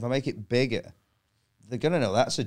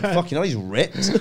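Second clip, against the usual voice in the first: laughing disbelief; 5 semitones higher, slower than usual. Retold or imitated. Seeing the hipster jump up, laughing amazed. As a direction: laugh out the surprise at his muscles. Shocked at the man's muscles.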